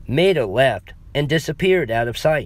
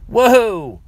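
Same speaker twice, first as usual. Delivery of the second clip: higher and louder startled yelp; startled exclaim; 11 semitones higher, 7 dB louder than usual. Acted. Clerk startled by the woman grabbing a hammer, loud yelp. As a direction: jump back as she grabs a hammer. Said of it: "I exclaimed".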